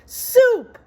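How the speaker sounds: high, loud squeaky rat call. A narrator's reading. Rat calls out to the others, high and loud. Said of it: "called out another rat".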